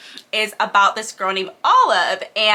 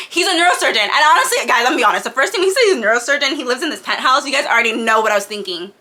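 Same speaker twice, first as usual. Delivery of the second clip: ordinary delivery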